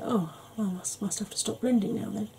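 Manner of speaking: ordinary talk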